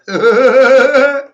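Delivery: loud, high burst of laughter; a real loud whooping laugh; big laugh. Real, not acted. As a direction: cackle in triumph. Triumphant laugh after boast.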